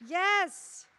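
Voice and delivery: slow, loud call to keep the applause going; hyping the crowd. Real, not acted. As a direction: drag it out big for the audience. Host calling to the crowd.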